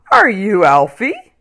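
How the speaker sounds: a big man greeting warmly; kindly big man. Acted. Big man asks at door.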